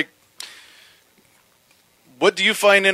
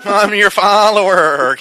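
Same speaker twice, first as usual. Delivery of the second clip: slow, high bleating sheep voice; little sheep voice; 5 semitones higher, 3 dB louder, slower than usual. Retold or imitated. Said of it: "little sheep voice".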